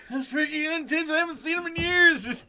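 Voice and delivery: put-on high, cackling lecherous old man. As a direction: leer like a dirty old geezer. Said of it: "like the old dude from, uh, Family Guy".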